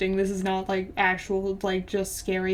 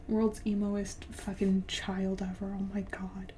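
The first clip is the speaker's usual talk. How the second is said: whispered, much softer aside; 2 semitones lower, 8 dB quieter than usual. Real, not acted. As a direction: mutter it in a whisper under your breath. Mocking aside after story.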